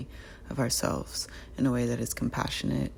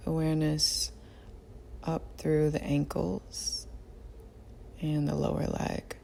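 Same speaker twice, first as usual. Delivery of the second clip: guided meditation; 6 dB quieter than usual. Real, not acted. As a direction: guide them gently through the body, unhurried. Leads a body scan meditation.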